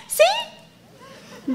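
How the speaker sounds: put-on tiny high child's yes; child's reply. Sulky kid answering parent, tiny high voice.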